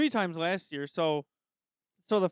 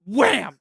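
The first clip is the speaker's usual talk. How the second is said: mock wham; 3 semitones higher, 9 dB louder than usual. Imitating a banging noise.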